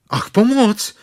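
high, loud cry for help. A narrator's reading. Pooh stuck in the burrow, crying out loud for help. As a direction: call out stuck in the hole. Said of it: "zvolal Pů".

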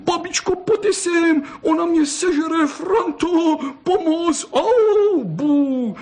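put-on shrill crying boy calling for help; howling and crying. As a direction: bawl for grandpa and grandma, hurt and scared. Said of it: "zakřikla a spustil".

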